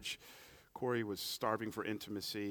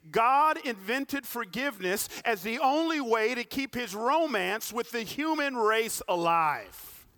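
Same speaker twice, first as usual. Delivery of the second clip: loud, high preaching cadence; impassioned sermon delivery; full-force preaching; 11 semitones higher, 10 dB louder than usual. Acted. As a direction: preach it out, pressing the climax.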